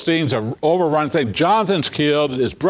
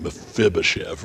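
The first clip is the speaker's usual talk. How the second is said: Mephibosheth rolled out lower and softer; savoring the name; 8 semitones lower, 4 dB quieter than usual. Shown as a demonstration. Preacher relishing the name, lower and softer. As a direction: let Mephibosheth roll off the tongue. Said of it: "just sort of rolls off the tongue".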